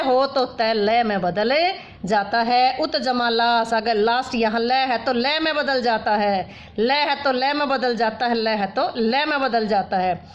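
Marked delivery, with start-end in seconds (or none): none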